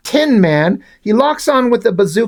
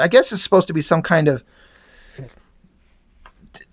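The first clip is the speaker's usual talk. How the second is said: normal talking voice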